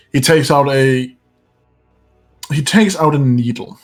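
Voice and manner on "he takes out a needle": ordinary talk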